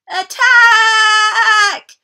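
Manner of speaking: very high, very loud shout; battle cry. Acted. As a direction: charge into battle. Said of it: "cried Captain Flynn".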